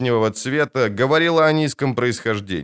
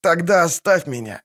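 moaning; 7 semitones higher than usual. A narrator's reading. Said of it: "простонал раненый".

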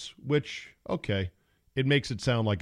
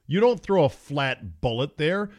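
ordinary talk

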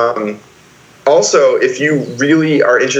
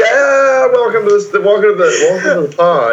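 energetic stream intro; 8 semitones higher, faster than usual. Shown as a demonstration. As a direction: hype podcast host welcome. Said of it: "you have an intro voice".

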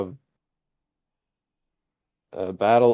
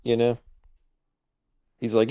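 usual speaking voice

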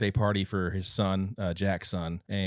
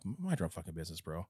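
curt dad; 4 semitones lower, 11 dB quieter than usual. Retold or imitated. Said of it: "And Jack's like".

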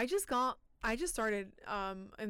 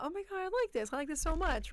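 excited inner voice; 8 semitones higher, faster than usual. Retold or imitated. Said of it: "And I thought".

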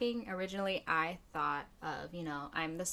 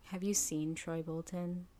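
ordinary talk, nothing marked

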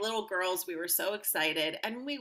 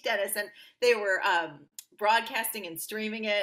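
normal talking voice

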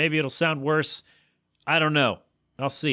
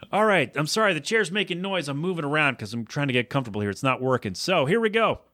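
ordinary delivery

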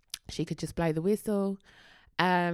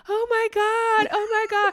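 imitated emotional crowd; 8 dB louder than usual. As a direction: play onlookers overcome by a reunion. Acts the crowd's emotional reaction.

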